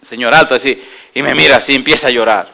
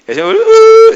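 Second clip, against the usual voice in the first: joyful outburst; 6 dB louder, slower than usual. Real, not acted. Joyful outburst about the Lord.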